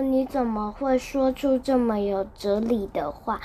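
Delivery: ordinary delivery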